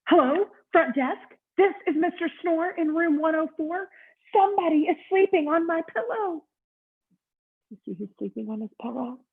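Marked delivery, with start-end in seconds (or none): 0.1-6.4 much higher, louder, indignant phone complaint; complaining guest. Acted. Mister Snore phoning the front desk about his pillow, indignant.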